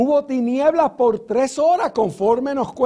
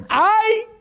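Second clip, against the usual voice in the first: high, drawn-out "ay"; wailed woe in a preacher's quote; angel crying woe; 10 semitones higher than usual. Acted. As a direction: act out the angel's cry, pitched well up. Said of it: "y él dice".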